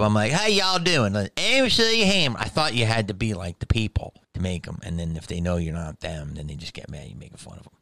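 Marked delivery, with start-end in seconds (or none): 0.3-2.4 much higher, hammed-up hillbilly accent. Comic mimicking how he greeted a hillbilly club crowd, hillbilly accent. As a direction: greet them like a hillbilly crowd member, lightly. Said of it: "if it was a hillbilly club".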